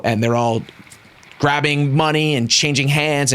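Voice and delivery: ordinary talk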